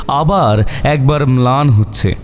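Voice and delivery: usual speaking voice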